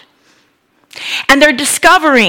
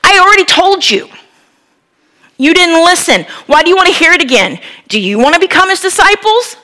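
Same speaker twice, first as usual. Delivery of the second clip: exasperated exclaim; 6 semitones higher than usual. Retold or imitated. Blind man snapping back at the Pharisees, exasperated. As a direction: throw it back at them, exasperated. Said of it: "he exclaims".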